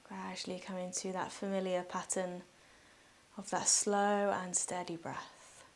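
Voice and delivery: soft, unhurried meditation voice with long pauses; guided meditation. Real, not acted. Yoga teacher guiding listeners through breathing, calm guided meditation.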